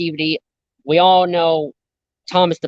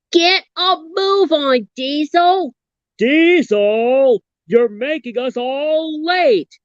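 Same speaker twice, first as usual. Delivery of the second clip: high, impatient engine voice; impatient cartoon train; 8 semitones higher, slower than usual. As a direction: hurry the other engine along. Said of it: "do another impression".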